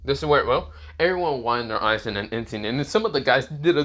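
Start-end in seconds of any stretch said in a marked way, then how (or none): none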